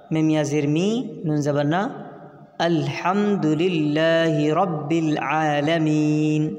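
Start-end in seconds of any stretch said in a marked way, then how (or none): 2.6-6.5 slow recitation with long held vowels; Quran recitation. Shown as a demonstration. Teacher joining up the spelled verse, slow recitation. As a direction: recite the verse slowly, stretching the long syllables.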